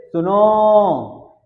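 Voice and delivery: सुनो with the final ओ held very long; very drawn-out summons; stressed calling. Shown as a demonstration. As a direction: call सुनो slowly, leaning on the last vowel. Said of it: "एक जोर लगा रहे हैं सुनो पे".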